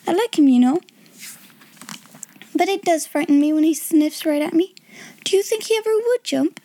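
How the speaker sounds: put-on a timid girl afraid of a rat; nervous young girl. A narrator's reading. Ermengarde voiced high.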